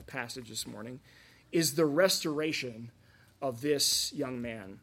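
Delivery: ordinary delivery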